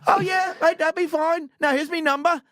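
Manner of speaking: high, hammed-up Aussie voice; insistent Australian recruiter on the phone; too-Australian accent. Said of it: "was real Australian accent".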